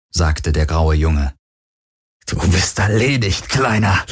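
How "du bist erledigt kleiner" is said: strained, pained threat; forced out in pain. Acted. Wounded henchman to armed boy, pressing out a threat. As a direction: squeeze the words out through pain. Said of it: "presste Heska unter Schmerzen hervor".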